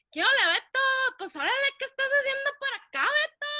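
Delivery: high, fast Mexicali border accent, hammed up; cachanilla accent. Cousin greeting Beto in a broad cachanilla accent. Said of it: "con su acento así súper cachanilla".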